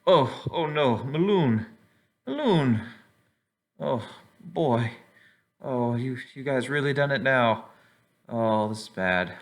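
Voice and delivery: high, wailing cries of dismay; dismayed Volo. Acted. Barkeep sees what happened to the victim, dismayed wail. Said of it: "Volo comes out from behind his, his bar, and he goes".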